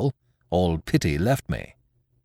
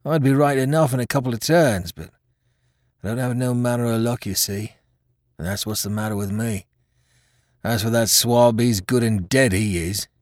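rough-spoken seaman grumbling about his luck; wounded pirate sailor; 3 semitones higher than usual. Acted. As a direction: play a hurt old mutineer, complaining. Voices the wounded pirate Hands.

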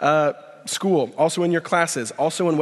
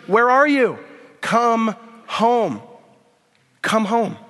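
rising, louder and higher pleading call; impassioned preaching; 5 semitones higher, 3 dB louder than usual. Acted. Pastor to congregation, pleading loudly with God's question.

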